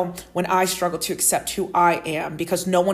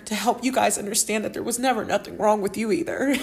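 normal talking voice

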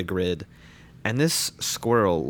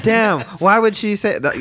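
higher, louder mock outrage; 11 semitones higher, 6 dB louder than usual. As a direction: play shocked for laughs.

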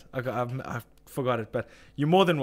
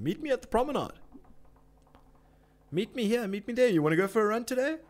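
high sing-song mimicry of the mates; sneering at mates who meet on the promenade; mocking friends; 7 semitones higher, faster than usual. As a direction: parrot the friends' invites, mockingly.

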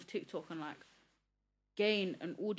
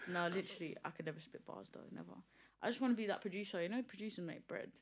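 ordinary talk

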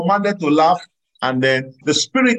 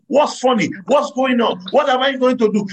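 higher-pitched, bewildered questions; mock confusion, pitched up; confused questioning; 9 semitones higher than usual. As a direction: act out puzzled, rising bewilderment. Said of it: "mind is so confused".

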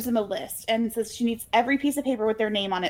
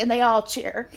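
ordinary talk, nothing marked

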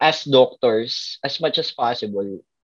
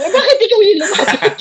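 high, loud, laughing question; laughing disbelief; 9 dB louder than usual. Retold or imitated. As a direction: ask it while cracking up in disbelief. Doctor's puzzled retold question. (natatawang nagtatanong nang hindi makapaniwala)

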